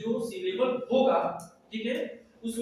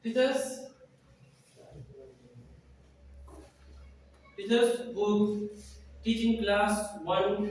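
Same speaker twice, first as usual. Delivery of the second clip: slow teaching read; much slower than usual. Acted.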